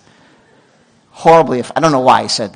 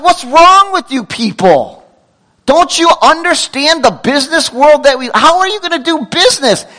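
higher, louder mock rant at the clerk; pretend yelling; 10 semitones higher, 3 dB louder than usual. Preacher pretending he yelled at the guard, mock angry. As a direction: play the angry customer, tongue in cheek. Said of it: "I yelled at the guy".

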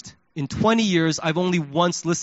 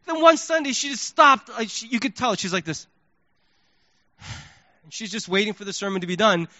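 normal talking voice

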